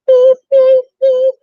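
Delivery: loud, very high beeping; piercing, evenly spaced beeps; alarm clock beeps. Acted. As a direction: imitate a shrill alarm clock. Imitates the alarm clock going off.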